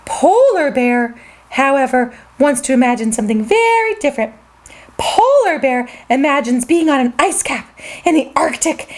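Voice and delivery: usual speaking voice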